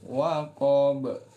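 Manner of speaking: waqab chanted with the pitch lifted; rising waqab. Shown as a demonstration. Teacher isolating the rise on waqab for students. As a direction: raise the note on waqab. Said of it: "Naik, ya".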